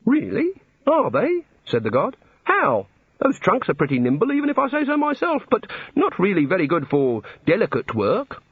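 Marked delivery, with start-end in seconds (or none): 0.1-1.4 high, rising questions; surprised god. A narrator's reading. The god learns something new. 2.4-8.4 high-pitched musing god voice; curious god. A narrator's reading. The god questions Ponder.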